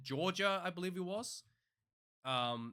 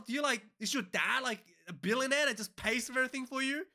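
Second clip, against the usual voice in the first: pitched way up, incredulous and fed up; exasperated rant; 7 semitones higher than usual. Real, not acted. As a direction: rant in disbelief, voice climbing high. Exasperated rant about paint complaint.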